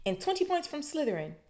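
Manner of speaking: high, stern punishment; stern teacher. A narrator's reading. McGonagall punishing Malfoy, stern and high. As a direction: hand down the penalty sternly.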